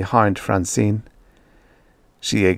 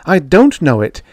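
high, louder indignant retort; raised pitch, heated and insistent; sudden anger; 6 semitones higher, 6 dB louder than usual. A narrator's reading. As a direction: flare up at a dismissive colleague. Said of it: "She spoke in sudden anger".

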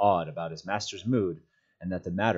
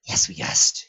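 forceful creature stage whisper; harsh, voiceless creature whisper; 4 semitones higher, 6 dB louder, slower than usual. A narrator's reading. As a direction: hiss it in a loud whisper. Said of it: "he whispered".

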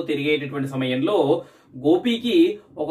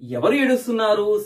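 ordinary delivery

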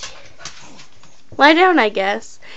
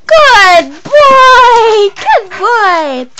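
high shout of praise to a dog; squeaky praising a dog; 11 semitones higher, 7 dB louder, much slower than usual. Real, not acted.

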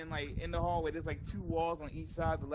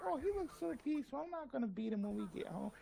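softer, higher, quick tender coo; softened mother; 6 semitones higher, 7 dB quieter, faster than usual. Retold or imitated. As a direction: imitate a mother softening over a sleeping child. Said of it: "your mother's like".

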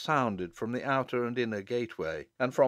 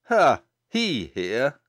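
exclaiming; 6 dB louder, much slower than usual. A narrator's reading. Wizard spotting the dark figure, startled exclamation. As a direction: burst out in sudden alarm. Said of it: "exclaimed Demdike".

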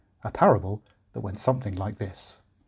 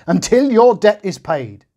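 very high, loud, slow; shout; 9 semitones higher, 8 dB louder than usual. A narrator's reading. The merciless servant finishing his shouted threat. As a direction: drive the threat home. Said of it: "he shouted".

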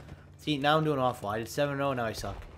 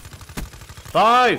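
loud, rising cheer on each kill; hyped kill count; 8 semitones higher, 11 dB louder than usual. Real, not acted. Gamer calling out his kill streak loudly. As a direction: count the kills out in triumph.

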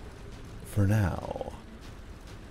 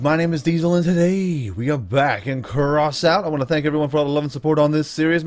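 bright YouTuber channel intro; upbeat intro; 4 semitones higher, 4 dB louder than usual. Acted. Gamer greeting his viewers, upbeat intro. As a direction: hype up the viewers at the start.

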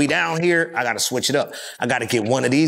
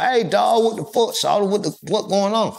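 Atlanta country twang; 7 semitones higher than usual. Retold or imitated. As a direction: talk like an Atlanta country boy. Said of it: "that country voice and that country twang".

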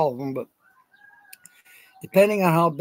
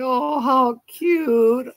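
put-on customer cooing over baby chicks, high drawn-out gushing; high, slow, sing-song coo at tiny chicks; 9 semitones higher, 4 dB louder, much slower than usual. Shoppers at the farm store admiring baby chicks, exaggerated gushing. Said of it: "everybody comes in and say".

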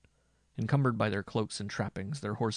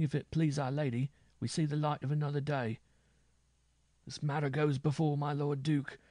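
higher-pitched minstrel, fresh from danger; shaken minstrel; 5 semitones higher than usual. A narrator's reading. Wounded minstrel vows action to Louis after attack, shaken. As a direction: speak high, as the rattled survivor.

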